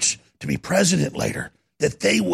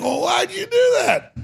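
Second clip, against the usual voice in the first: dying frog; 13 semitones higher than usual. Retold or imitated. Dying frog asking scorpion why, high mock wail. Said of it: "the frog's sitting there dying".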